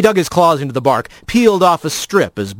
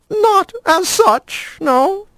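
very high, slow and prim; a hesitant formal lizard; much slower than usual. A narrator's reading. Lizard answers.

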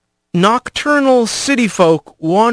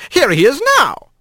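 higher, dry teasing greeting; wry Skink; 10 semitones higher than usual. A narrator's reading. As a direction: announce him with a wry edge.

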